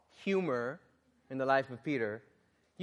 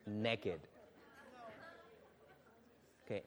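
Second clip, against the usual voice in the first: quiet laughter; 5 semitones lower, 13 dB quieter than usual. Real, not acted. Laughing at the naked exorcists.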